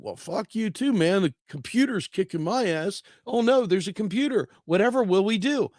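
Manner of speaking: hammed-up high cartoon dread; mock panicked calculator. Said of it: "calculator's like".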